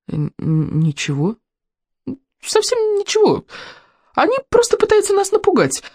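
halting, stammered reassurance; hesitate, then insist nothing is wrong; uneasy boy trying to sound unworried; nervous muttering. A narrator's reading. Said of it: "пробормотал Икинг".

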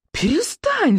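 high, stretched cry; yelling. A narrator's reading. Boy behind the mast begging attacker to stop, yelling. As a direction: cry out to make him stop. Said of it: "завопил Икинг".

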